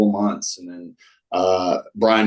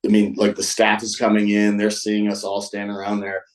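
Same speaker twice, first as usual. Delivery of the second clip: usual speaking voice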